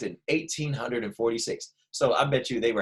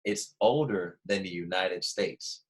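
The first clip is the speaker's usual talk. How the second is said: put-on older drawn out slowly, low and soft; slow, careful model answer for kids to copy; 4 semitones lower, 3 dB quieter, slower than usual. Shown as a demonstration. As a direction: say It's older than the United States slowly, leaning on older. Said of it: "just like that".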